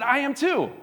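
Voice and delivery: high, eager exclamation; excited agreement. Acted. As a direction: exclaim it with mock delight. Joke's delighted agreement, acted.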